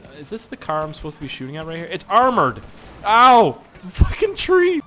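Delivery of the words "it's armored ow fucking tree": loud pained outburst; yelling. Real, not acted. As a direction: shout at the crash. Crashing into a tree.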